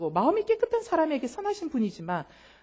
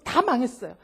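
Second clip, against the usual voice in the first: ordinary delivery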